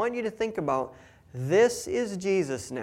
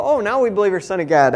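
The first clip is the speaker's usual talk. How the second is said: lightly mocking, louder, higher disciples; 4 semitones higher, 9 dB louder, faster than usual. Preacher mimicking the disciples' late belief, light mockery. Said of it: "and they go".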